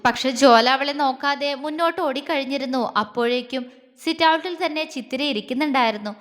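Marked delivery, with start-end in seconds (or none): none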